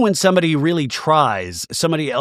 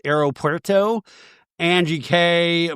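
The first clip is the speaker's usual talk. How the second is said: ordinary talk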